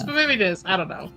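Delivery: ordinary talk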